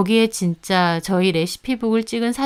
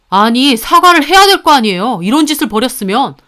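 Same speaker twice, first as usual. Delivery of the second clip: indignant, raised voice; angry demand; 3 semitones higher, 7 dB louder than usual. Acted. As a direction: press hard for an apology. Husband demands an apology from sister.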